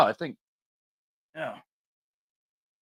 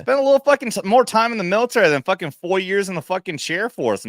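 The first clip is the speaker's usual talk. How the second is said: high, loud imagined retort; mock telling-off; 10 semitones higher, 5 dB louder than usual. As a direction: snap back at the airman like a drill sergeant. Mock comeback to Jesse.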